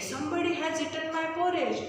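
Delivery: ordinary talk, nothing marked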